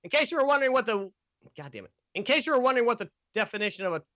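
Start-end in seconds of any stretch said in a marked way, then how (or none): 1.6-1.9 low, much softer curse under the breath; annoyed self-directed curse, dropped low and soft; muttered curse. Real, not acted. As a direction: swear to yourself after fumbling the line. Flubbed retake, curses under breath.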